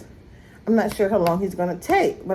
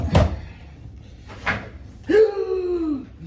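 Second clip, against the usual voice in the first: mock gasp; 11 semitones higher than usual. Mock shock at own mess.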